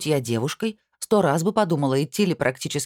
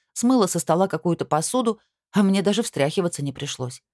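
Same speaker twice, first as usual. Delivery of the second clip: usual speaking voice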